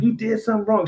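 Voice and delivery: high-pitched scolding of a pet; mock accusing voice; retold scolding. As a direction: tell off a dog, playfully retold. Scolding the dog in story.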